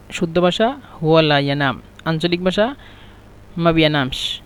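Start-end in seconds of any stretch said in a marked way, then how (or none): none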